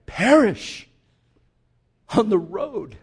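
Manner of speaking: perish bursts out loud and high; shouted. Real, not acted. Preacher crying out the word to the church.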